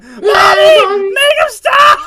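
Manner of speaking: very high, loud hammed-up wail; crying kid. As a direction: scream like a terrified child, playfully. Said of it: "Kids are crying in the background".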